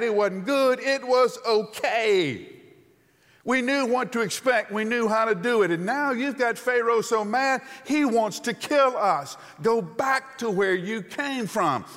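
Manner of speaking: high, loud griping; whiny complaining. Acted. Hebrews griping at Moses in a high, loud whine. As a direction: gripe at Moses like a fed-up crowd. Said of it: "The Hebrews tell Moses".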